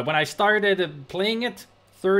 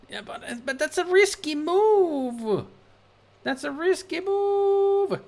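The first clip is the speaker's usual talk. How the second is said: anxious protest; 10 semitones higher, slower than usual. Real, not acted. Worried about reloading emulator.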